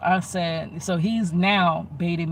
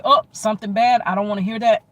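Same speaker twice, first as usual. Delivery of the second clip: mock flare-up, higher and louder; raised argumentative voice; 4 semitones higher, 6 dB louder, faster than usual. Imitating him leaping from conversation to arguing, raised voice. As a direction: jump up in volume, imitating someone cutting you off. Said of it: "They get up here".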